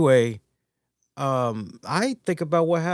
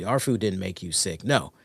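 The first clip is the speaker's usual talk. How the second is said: ordinary talk, nothing marked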